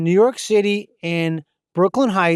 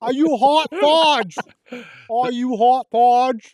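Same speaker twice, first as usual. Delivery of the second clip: drawn-out Boston voice; Boston accent; 3 semitones higher, slower than usual. Pretend. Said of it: "super thick Boston accent".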